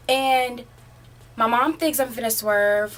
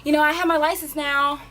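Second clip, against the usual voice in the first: higher, louder mock teen protest; acted-out complaint, pitched up; protesting to mom; 6 semitones higher, 4 dB louder than usual. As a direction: object to mom like an exasperated kid. Protesting to mom in retold exchange.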